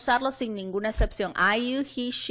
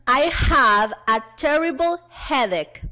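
slow, loud classroom model sentence; projected, deliberate teacher reading; read-along model; 3 semitones higher, 5 dB louder, slower than usual. Shown as a demonstration. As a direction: lead the class, stretching each word for repetition. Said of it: "vamos todos a leer nuestras oraciones".

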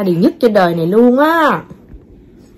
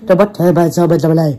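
robot voice; 3 semitones lower, 3 dB louder than usual. Retold or imitated. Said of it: "robot nói chuyện vậy hả con".

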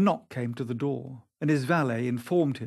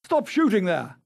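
raised, high command; calling out loudly; 6 semitones higher, 5 dB louder than usual. A narrator's reading. Sir Geoffrey to the shooters, calling at the top of his voice. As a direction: call out to halt the shooting. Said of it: "called out at the top of his voice".

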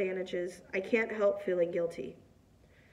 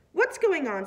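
higher, slightly hysterical question; a touch of panic, pitched up; note of hysteria; 5 semitones higher, 4 dB louder than usual. A narrator's reading. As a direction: demand to know, mildly frantic. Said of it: "a note of hysteria in her voice".

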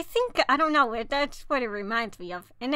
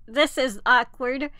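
ordinary talk, nothing marked

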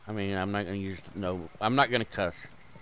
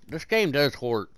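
ordinary talk, nothing marked

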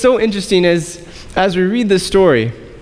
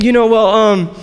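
stalling hesitation. Retold or imitated. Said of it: "I was like".

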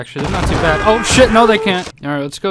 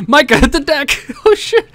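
surprised; 9 semitones higher, 4 dB louder than usual. Real, not acted. Minecarts raining down.

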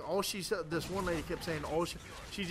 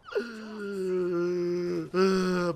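frustrated sigh; 5 dB louder than usual. Real, not acted. Camper keeps killing him.

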